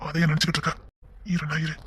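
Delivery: tender murmured reassurance; hushed and tender. Acted. Intimate night talk to wife.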